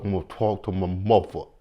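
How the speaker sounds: imitating Kanan's put-on accent; mocked Kanan accent. Mocking Kanan telling a kid he'll talk to his mother, in his accent. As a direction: do the annoying accent on the quote. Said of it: "That accent".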